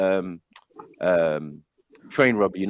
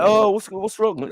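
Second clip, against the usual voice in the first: slow, higher, concerned teacher; 6 semitones higher, slower than usual. Retold or imitated. Lightly voiced teacher asking a crying pupil, slow and gentle. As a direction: ask gently like a worried teacher. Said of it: "Teacher's like".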